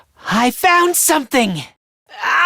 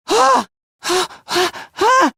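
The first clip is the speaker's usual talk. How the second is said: short, high gasps of shock; string of startled, high-pitched gasps; panicked gasps; 6 semitones higher, slower than usual. Acted. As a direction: gasp repeatedly as the slip sinks in. Acted culprit realises slip.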